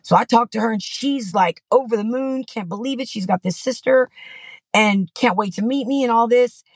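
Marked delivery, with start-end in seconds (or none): none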